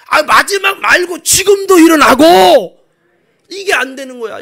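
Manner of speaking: high shouted correction; full-force preaching. Real, not acted. Preacher corrects Martha's limited faith, full-force preaching.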